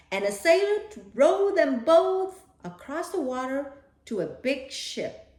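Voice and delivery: usual speaking voice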